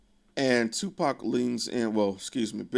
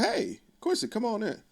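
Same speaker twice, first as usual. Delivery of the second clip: high woman voice; 11 semitones higher than usual. Retold or imitated. Yolanda greeting Quincy, voiced.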